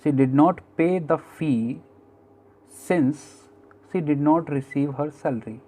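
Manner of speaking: very slow, word by word English reading; slow dictation. Shown as a demonstration. Teacher dictates the example sentence to students, very slowly. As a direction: read it out slowly for the class to write. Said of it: "एक और sentence देखो".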